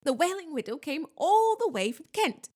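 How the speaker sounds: proud. A narrator's reading. Ghost host boasts to his young guests, proud. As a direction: show off the distinguished guest. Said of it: "said Nearly Headless Nick proudly".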